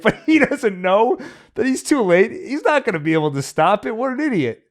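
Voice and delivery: high, loud, laughing villain sneer; gleeful mockery. Von Franz laughing at Thomas trying to save his wife, high gleeful sneer. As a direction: cackle at the fool who's too late.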